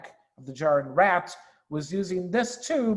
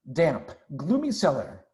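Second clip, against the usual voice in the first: ordinary delivery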